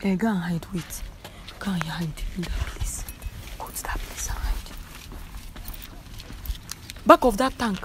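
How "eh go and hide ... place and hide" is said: hushed unvoiced whisper. Real, not acted. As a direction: whisper so she won't hear. Prank setup, hiding from her.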